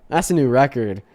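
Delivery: normal talking voice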